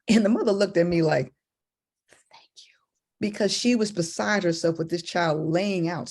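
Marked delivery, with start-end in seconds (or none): 2.1-2.7 barely audible grateful whisper; hushed, secret thank-you. Retold or imitated. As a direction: mouth it with just breath. Mother mouths thanks.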